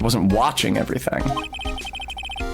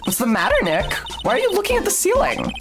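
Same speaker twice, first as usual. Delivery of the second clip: put-on girl voice; 13 semitones higher, faster than usual. Voicing Maya.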